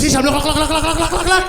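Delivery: high, loud, sugar-rushed repeated urging; vibrating with excitement. Acted. Sugar-buzzed mom urges her son to look, frantically excited. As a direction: rattle it out like an overexcited mom. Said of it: "She's vibrating".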